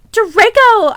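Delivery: high, loud call of alarm; alarmed cry. Acted. Narrator crying out to the crying Draco. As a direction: cry his name in fear. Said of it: "I cried".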